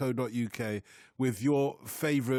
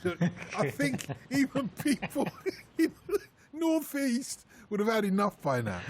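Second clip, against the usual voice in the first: laughing; 7 semitones higher than usual. Real, not acted. Laughing plea to stop.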